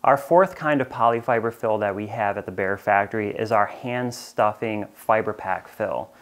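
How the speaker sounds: ordinary talk, nothing marked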